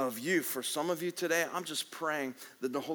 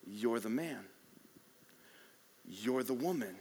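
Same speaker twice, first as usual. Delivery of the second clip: quiet accusation; 4 semitones lower, 5 dB quieter than usual. Acted.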